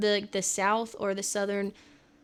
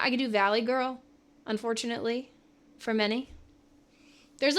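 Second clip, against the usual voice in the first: ordinary talk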